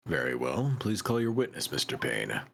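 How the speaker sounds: deep, deliberate courtroom judge; old judge voice. Acted.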